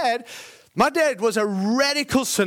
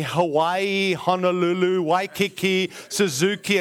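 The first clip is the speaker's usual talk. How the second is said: mock tongues; slower than usual. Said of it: "and all this nonsense".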